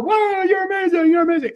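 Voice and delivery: high, excited crowd cheer; high-pitched gushing fans. Retold or imitated. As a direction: cheer him on like a wowed crowd. Crowd cheering on the tightrope walker.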